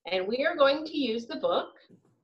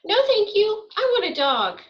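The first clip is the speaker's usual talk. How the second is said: higher, louder, firm refusal; insistent child; 10 semitones higher, 5 dB louder than usual. Acted. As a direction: play a child politely but firmly insisting. Child refusing at animal shelter.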